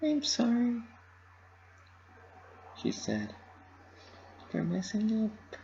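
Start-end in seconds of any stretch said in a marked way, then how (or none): 0.0-0.8 high pony voice, a small apology; high and sorry, barely holding together; Pinkie Pie voice. Acted. 4.5-5.6 high pony voice, a small apology; high and sorry, barely holding together; Pinkie Pie voice. Acted.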